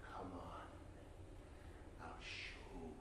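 low, slow, very quiet stranger; temper held back. Acted. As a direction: say it softly and deep, reining in anger. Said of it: "like he was holding back his temper".

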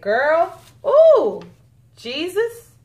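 high, loud burst of delight; excited swooning. Real, not acted. Vlogger reacting to shimmery polish, excited exclamation. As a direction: let it leap up, overcome by the color.

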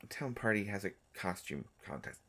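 ordinary talk

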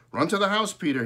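high, loud urgent call; crying out. A narrator's reading. Father shouts to son over the storm, loud. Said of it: "he cried".

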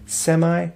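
"semi" ending in an eye sound; semi as SEM-eye. Shown as a demonstration. Teacher showing the common U S A pronunciation, "semi" as SEM-eye. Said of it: "Most people in the U S A pronounce it".

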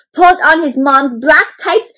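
plain reading voice

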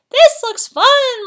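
high, loud, drawn-out squeal of fun; excited shriek. A narrator's reading. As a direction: shriek like a gleeful monkey. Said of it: "Monkey shrieked as he sprang aboard".

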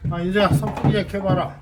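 loud call to someone away; yelling across the room. Real, not acted. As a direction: shout the request across the room. Calling to Insu across room. (멀리 있는 사람을 부르는 큰 소리)

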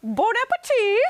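very high, hooting TV chef catchphrase; Julia Child. Shown as a demonstration. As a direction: pitch it sky-high, like a famous cookery host signing off. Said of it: "I'll do one more".

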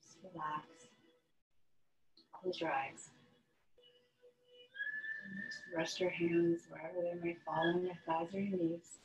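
calm yoga breathing guidance with long pauses; unhurried meditation voice, letting each phrase settle; guided meditation. Real, not acted. Yoga teacher settling students into breath and relaxation, guided meditation.

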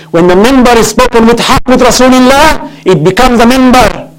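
high, ringing pulpit proclamation; rousing sermon climax; impassioned preaching. Real, not acted. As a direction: drive the point home fervently. Preaches at full force.

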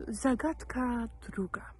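usual speaking voice

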